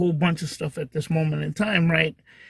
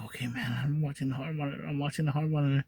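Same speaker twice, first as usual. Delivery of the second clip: hushed, much softer murmur; quiet to himself; 3 semitones lower, 10 dB quieter, faster than usual. Retold or imitated. Son watching the monitor beside his sleeping mom, hushed. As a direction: murmur it so mom stays asleep.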